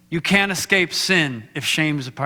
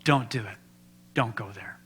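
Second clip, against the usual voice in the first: soft, hushed and low; gently warn, barely above a breath; a hushed divine warning; quiet voice of God; 6 semitones lower, 8 dB quieter than usual. Retold or imitated. God's voice retold, quiet.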